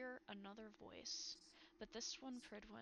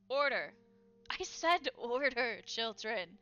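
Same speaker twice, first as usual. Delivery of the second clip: loud call to silence the room; commanding shout; 3 semitones higher, 13 dB louder than usual. Acted. Ruler shouting down the noisy court, commanding. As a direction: bellow for order over the noise. Voice cutting through a crowd.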